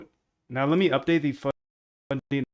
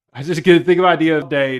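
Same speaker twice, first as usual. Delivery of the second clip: higher, much louder exclamation; loud outburst; 5 semitones higher, 13 dB louder than usual. Real, not acted. Streamer exclaiming about getting to think of ideas, loud. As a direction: burst out with it, then let the pitch fall. Bursts out about his daily life.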